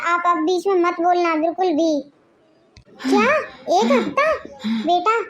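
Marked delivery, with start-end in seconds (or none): 3.0-5.2 can't believe what she hears; shocked disbelief. Acted. Mother reacts to her son's one-week plan, shocked disbelief.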